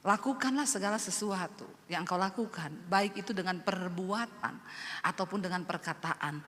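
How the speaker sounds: low, soft, measured verse reading; scripture recitation. Retold or imitated. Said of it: "ayat firman Tuhan yang berkata begini".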